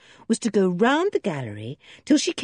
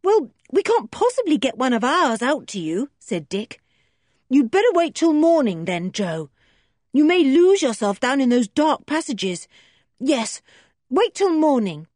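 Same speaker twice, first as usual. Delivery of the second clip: put-on encouraging boy; 6 semitones higher, 4 dB louder than usual. Dick voiced.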